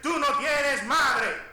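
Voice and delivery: loud, high, drawn-out mock insult; insulting outburst. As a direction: hurl it slowly like an ugly insult. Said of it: "Suena feo, suena vulgar". (insulto fingido a gritos)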